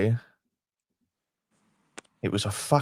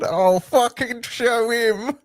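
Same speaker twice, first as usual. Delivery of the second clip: high, slow mock Kev voice; imitating Kev; 10 semitones higher, slower than usual. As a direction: ham up a pompous friend, slowly. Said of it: "This is Kev saying".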